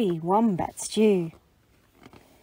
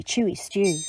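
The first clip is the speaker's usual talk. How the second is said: plain reading voice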